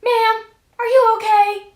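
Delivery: high, loud put-on neighbor voice; exaggerated high check-in; mock concerned neighbor. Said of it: "and she's like".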